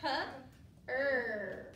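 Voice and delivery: "P, er" split into separate sounds; "P" then "er", paused between; sounding out. Shown as a demonstration. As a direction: break "P" and "er" apart for the class. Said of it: "You ready?".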